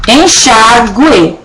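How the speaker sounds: "enxaguei" drawn out slowly; slow demo. Shown as a demonstration. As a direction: spell out "enxaguei" slowly for the class. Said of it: "ficava então como aqui".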